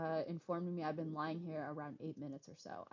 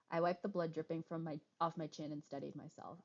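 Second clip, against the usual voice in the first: ordinary talk, nothing marked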